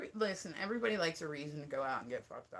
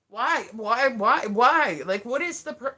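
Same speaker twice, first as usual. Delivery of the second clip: loud, higher, repeated demand; incredulous outburst; exasperated bafflement; 5 semitones higher, 12 dB louder, slower than usual. Real, not acted. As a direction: fire off each why louder, utterly baffled. Baffled by hat, demands reason.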